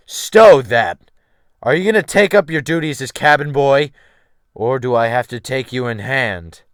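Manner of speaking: loud, threatening bark; harsh captain's order. Acted. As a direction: cut him off and bully him into obeying. Captain threatening new cabin boy.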